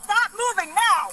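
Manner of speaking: commanding shout. Real, not acted. Officer restraining a suspect.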